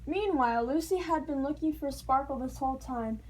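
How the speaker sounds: ordinary delivery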